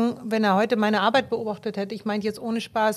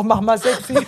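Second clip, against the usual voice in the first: laughing mock photographer; 6 dB louder than usual. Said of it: "Ich üb heute noch".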